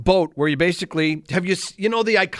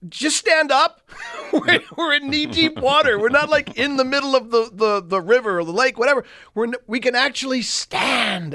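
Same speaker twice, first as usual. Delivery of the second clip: much higher, laughing into the words; laughing reassurance; 7 semitones higher than usual. Retold or imitated. Husband telling wife to just stand up, laughing. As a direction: call to her, amused relief. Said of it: "I'm like".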